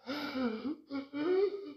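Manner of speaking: sharp high dying gasp; suspiro de moribunda. Acted. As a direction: gasp for air like a dying grandmother. Avó moribunda ofegando. (arquejo agudo de moribunda)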